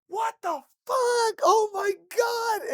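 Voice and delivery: slow, high screaming in disbelief; drawn-out panicked shriek; shocked scream. Retold or imitated. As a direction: shriek it out, horrified. Friend vomits at hookah bar.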